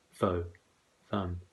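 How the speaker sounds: ordinary delivery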